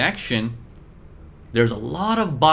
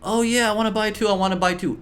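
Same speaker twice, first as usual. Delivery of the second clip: greedy eager buyer; 9 semitones higher, faster than usual. Retold or imitated. Teacher voicing greedy crowd begging to buy, pitched-up eager voice.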